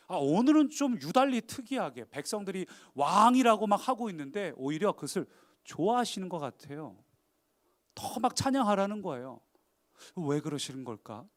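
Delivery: ordinary talk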